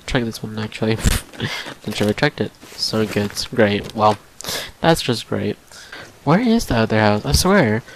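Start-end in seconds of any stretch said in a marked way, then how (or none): none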